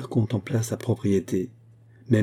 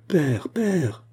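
high, excited cry of joy; eager cry; 7 semitones higher than usual. A narrator's reading. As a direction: call out to her father, delighted. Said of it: "s'écria-t-elle". (s'écrie avec joie, aiguë)

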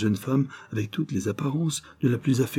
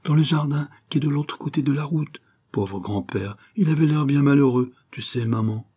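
put-on higher child's voice, turning to pity; earnest little boy; 6 semitones higher than usual. Boy tells his mother of poor grandfather, childlike and sympathetic. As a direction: explain it to mum as a small boy.

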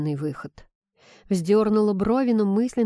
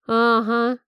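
slightly higher, bitter agreement; bitter scoff; 4 semitones higher, 4 dB louder than usual. A narrator's reading. Friend scoffs at the heroine's guess, bitter. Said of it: "горько усмехнулась подруга".

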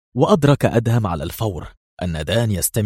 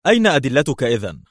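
stern, higher-pitched challenge; 5 semitones higher, 4 dB louder than usual. A narrator's reading. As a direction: demand proof severely. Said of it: "سأله المسؤول في صرامة".

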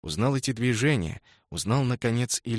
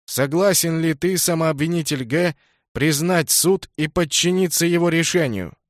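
ringing high herald's call; town crier; 6 semitones higher, 7 dB louder than usual. A narrator's reading. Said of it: "звонким голосом глашатая спросил".

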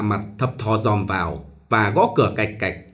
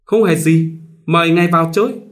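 louder, higher, eager welcome; hopeful eagerness; 7 semitones higher, 5 dB louder than usual. Acted. Minh inviting the stranger in, eager and hopeful. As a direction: welcome him in, barely hiding hope. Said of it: "Lửa hy vọng ngùn ngụt".

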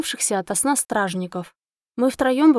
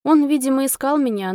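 plain reading voice

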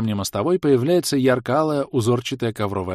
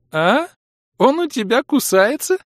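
high, loud stunned echo; 5 dB louder than usual. A narrator's reading. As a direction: repeat it in total shock. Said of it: "ошарашенно повторила Хлоя".

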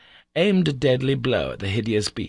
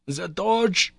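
higher urgent cry, professor in alarm; wild cry; 9 semitones higher than usual. A narrator's reading. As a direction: call for the torch in alarm. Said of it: "cried the professor wildly".

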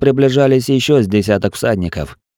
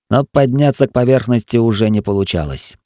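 usual speaking voice

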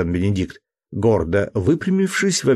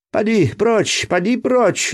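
much higher cry to shoo the dog; shooing shout; 13 semitones higher, slower than usual. A narrator's reading. Benedict shoos the dog away, mock yell. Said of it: "закричал тот".

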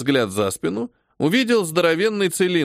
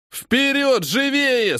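shouting orders over battle; 11 semitones higher, much slower than usual. A narrator's reading. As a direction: hurry them on with a yell. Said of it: "скомандовал сержант". (сержант подгоняет бойцов криком)

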